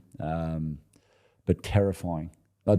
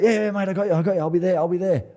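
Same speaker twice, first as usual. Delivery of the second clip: breezy teammate; 10 semitones higher, 4 dB louder, faster than usual. Retold or imitated. Teammate's breezy promise retold.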